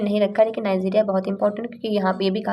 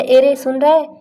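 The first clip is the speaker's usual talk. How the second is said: higher, louder casual call; 4 semitones higher, 6 dB louder than usual. Shown as a demonstration. Teacher shows how people call out, higher and louder. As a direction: call out to get someone's attention. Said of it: "commonly बोल नहीं देते हैं".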